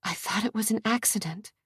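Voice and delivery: soft, stunned whisper. Acted. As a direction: barely breathe the words out. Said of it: "she whispered".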